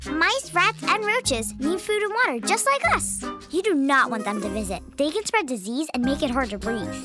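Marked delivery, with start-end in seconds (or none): none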